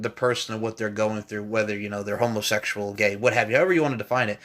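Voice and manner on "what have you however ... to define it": ordinary delivery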